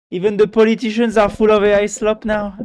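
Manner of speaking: loud, high mocking outrage; incredulous. Real, not acted. Scoffing at politicians using AI text, loud disbelief. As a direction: bring the disbelief out.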